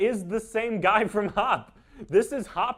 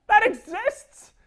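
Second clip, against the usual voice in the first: even higher, louder, slow "That exists?"; stunned disbelief; 11 semitones higher, 9 dB louder, slower than usual. Real, not acted. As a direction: stretch the shock out further. Shocked repeat.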